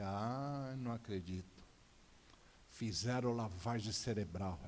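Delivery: low, slow sigh of disbelief; dismayed disbelief. Retold or imitated. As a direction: let it sink in, low and heavy. Father finds daughter praying, dismayed.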